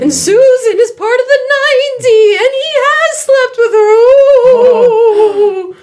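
loud high gossiping gasp; scandalized high-pitched gossip; gossipy squeal. Acted. Gossiping Susan exclaims.

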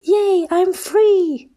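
very high, slow, drawn-out cheer; exclaiming. Acted. The freed little Triceratops cheers its rescuers, very high and slow. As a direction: squeal with joy at being free. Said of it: "the Triceratops exclaimed".

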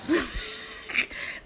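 soft breathy nervous laugh; quiet nervous giggle. Real, not acted. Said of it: "That was a nervous laugh".

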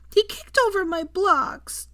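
put-on high, drawn-out child's protest. A narrator's reading. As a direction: protest slowly like an aggrieved little boy. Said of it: "Daniel protested".

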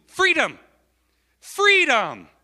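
loud, high preacher's cry; high, forceful falling shout; rousing cry. Real, not acted. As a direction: ring out "freedom" to the congregation. Preacher cries out the promised freedom.

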